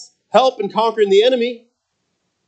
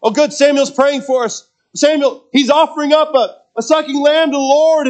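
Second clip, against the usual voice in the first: higher-pitched voicing of hopeful onlookers; higher, relieved commentary from the crowd; relieved Israelites; 5 semitones higher than usual. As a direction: speak up high, as the Israelites watching Samuel. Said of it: "put yourself in their shoes".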